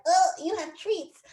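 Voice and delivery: high, slow, amazed doggy voice; be a dog discovering treats; high-pitched drawn-out delight; voicing a dog. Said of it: "Your dog's gonna be like".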